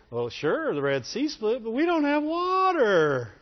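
high whining complaint, dragged out; mock whiny Israelites. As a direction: whine like a spoiled child. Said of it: "with the whiny voice".

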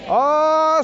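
ordinary talk, nothing marked

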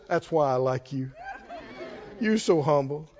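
low, soft, amused voice of the Lord; the Lord's voice. Retold or imitated. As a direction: drop low and tease him gently. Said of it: "the Lord looked at Moses and said".

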